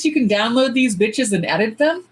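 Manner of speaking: ordinary delivery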